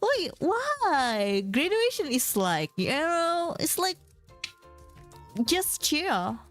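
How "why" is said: ordinary talk, nothing marked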